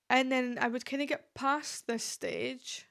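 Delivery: ordinary talk, nothing marked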